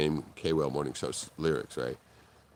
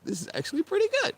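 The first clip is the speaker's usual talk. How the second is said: surprised. Retold or imitated. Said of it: "They're like".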